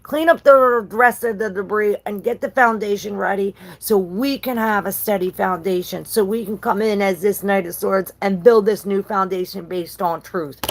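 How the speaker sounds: a king commanding the cleanup; voicing the king. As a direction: give the order like a king rallying troops. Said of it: "It's like".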